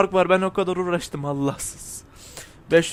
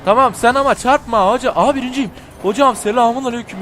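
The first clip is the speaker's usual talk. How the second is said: loud, high thrilled call; excited; 6 semitones higher, 7 dB louder than usual. Real, not acted. Gamer cheering as he takes the lead. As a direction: cheer as you take first. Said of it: "Çok pis heyecan yaptım".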